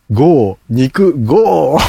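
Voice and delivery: loud, high, punchy wordplay; excited pun. Shown as a demonstration. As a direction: shout the pun out proudly. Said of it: "いい語呂合わせはないですかね".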